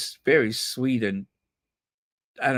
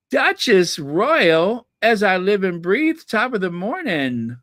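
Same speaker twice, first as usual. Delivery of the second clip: gushing fan; 5 semitones higher than usual. Pretend. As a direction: fawn over the Duchess Royal, pitched higher. Gushing fan greeting the Duchess.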